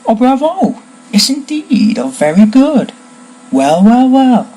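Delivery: high, loud exclamations of delight; cried out, jubilant. A narrator's reading. Wand seller cheers as sparks fly from wand, jubilant cry. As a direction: applaud with soaring, astonished praise. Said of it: "Mister Ollivander cried".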